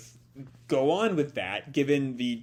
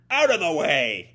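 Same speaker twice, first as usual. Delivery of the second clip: louder, fierce hero yell; battle shout; 3 semitones higher, 6 dB louder than usual. Acted. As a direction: clear the path with a fiery shout. Natsu blasting enemies aside.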